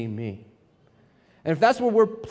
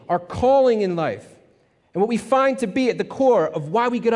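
raised, measured pulpit delivery; preaching; 4 semitones higher than usual. Acted. Pastor warning his church about self-love. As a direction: preach it to the congregation with weight.

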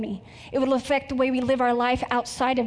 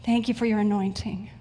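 earnest, measured prayer voice; 2 semitones lower than usual. Acted. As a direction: congregation prayer before the sermon, reverent and unhurried. Opens sermon with prayer.

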